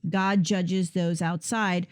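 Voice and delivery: normal talking voice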